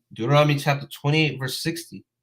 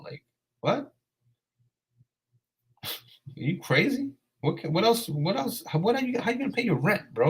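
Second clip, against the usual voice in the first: incredulous disbelief; 8 semitones higher, 4 dB quieter than usual. Real, not acted. Streamer disbelieves a viewer who doesn't care about money, incredulous. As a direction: pitch jumps much higher in disbelief.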